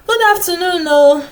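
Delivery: bright, loud chorus of greeting; cheerful group greeting. Acted. Beggars loudly answering the young woman's greeting. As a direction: call back a hearty hello.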